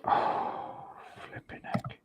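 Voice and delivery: breathy whispered frustration; whispered curse. Real, not acted. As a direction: whisper it under your breath. Muttering frustration while painting.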